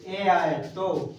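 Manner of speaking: very slow, prompting the class; slow dictation. Shown as a demonstration. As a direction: dictate slowly so they fill the blank. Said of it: "दो consonant के बीच क्या आए?".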